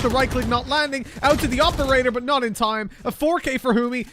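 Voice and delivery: high, loud rising hype on the ace; excited commentary. Real, not acted. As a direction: build the call to a climax.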